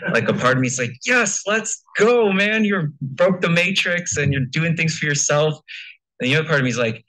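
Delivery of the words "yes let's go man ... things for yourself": high, slow, hyped-up cheering; excited cheer. Retold or imitated. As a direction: cheer him on like a fan.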